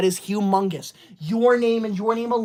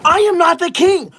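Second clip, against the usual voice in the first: mock shout; 8 semitones higher, 5 dB louder than usual. Insists on title, repeated correction.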